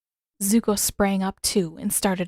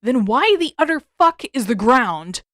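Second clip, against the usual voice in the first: exasperated outburst; 5 semitones higher, 7 dB louder than usual. Acted. Sokka exasperated at shaking ground.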